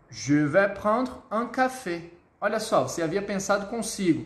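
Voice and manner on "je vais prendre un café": slow, careful café order; deliberate French demonstration; slow model sentence. Shown as a demonstration. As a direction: order word by word for learners to copy.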